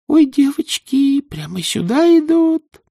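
high, slow squealing panic; squeaky panic. Acted. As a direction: squeal in alarm. Vava panics, enemies coming.

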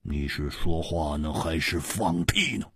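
low, slow, quiet contempt; dry, soft-voiced put-down; scornful retort.

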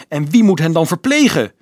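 high, loud outraged question; shrill-pitched, shouted protest; indignant yell. A narrator's reading. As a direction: demand it in indignation. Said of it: "gilde Carolus verontwaardigd".

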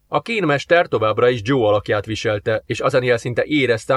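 usual speaking voice

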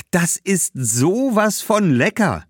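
hoch, langsam und genießerisch; schwärmt von der Soße; schwärmend. Acted. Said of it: "schwärmte Badbal". (Badbal schwärmt vom Garum, hoch und langsam)

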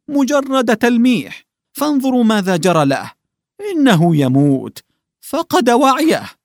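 put-on old man crying out in grief to the household; anguished shout. A narrator's reading. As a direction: cry out in despair. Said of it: "وصاح في أسى".

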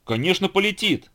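high-pitched, eager little exclamation; eager cry. A narrator's reading. Silly bear cub sure the plane will fly, eager cry. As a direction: pipe up high, fully convinced. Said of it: "закричал глупый медвежонок".

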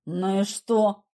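low, unimpressed reply; indifferent. A narrator's reading. Igor dismisses the stain, indifferent. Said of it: "Сохраняя безразличный вид".

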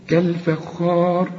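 كالفخار slow with a heavy emphatic kha; the right way; correct recitation. Shown as a demonstration. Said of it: "الخاء هي البس المفخمة".

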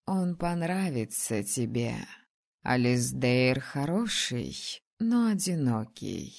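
slow, low, knowing dragoness; calm wise dragon voice. Acted. Dragon Frida speaks.

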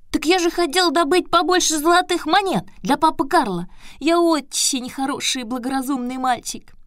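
put-on high boyish puppet voice. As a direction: protest like an eager boy. Buratino answers the turtle.

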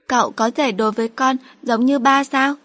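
put-on a small girl asking hopefully; young daughter.

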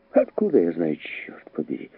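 muted exasperation; irritated, dropping to a near whisper; exasperated outburst. A narrator's reading. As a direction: swear under the breath in annoyance. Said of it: "в сердцах воскликнул мистер Андерхилл".

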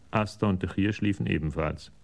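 ordinary talk

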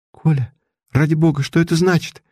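put-on high, scared young lady's plea; frightened woman's voice. A narrator's reading. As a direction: beg Kolya for an answer, frightened. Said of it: "испуганный женский голос".

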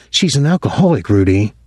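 usual speaking voice

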